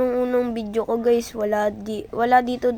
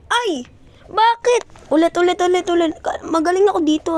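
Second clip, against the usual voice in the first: frustrated yelling; 7 semitones higher, 6 dB louder than usual. Real, not acted. Failing a jump repeatedly.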